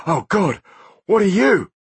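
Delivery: high, panicked cry; terrified cry. Acted. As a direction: cry out in terror. Blunt sees the ghost.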